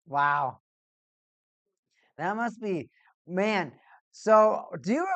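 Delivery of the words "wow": ordinary delivery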